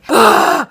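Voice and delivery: loud, high exasperated outburst; frustrated groan. Acted. Storyteller exasperated that nobody got the message, frustrated groan. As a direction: burst out in wordless frustration. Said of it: "It was so frustrating".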